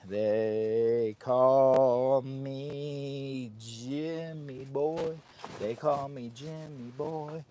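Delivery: slow, drawn-out showman intro; hammed-up announcer. Vlogger entertaining viewers, hammed-up showman intro. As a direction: announce yourself like a stage act. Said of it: "I'm here for your entertainment".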